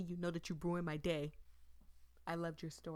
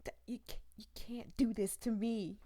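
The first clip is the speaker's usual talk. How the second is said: mock pleading; 4 dB louder than usual. Pleading with dying girl.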